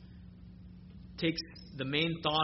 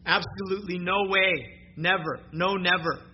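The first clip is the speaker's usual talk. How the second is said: "never" and "no" punched louder each time; hammering negatives; 3 semitones higher, 5 dB louder than usual. Shown as a demonstration. Preacher drilling God's promise home to church, louder. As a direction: slam down every negative. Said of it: "five negatives".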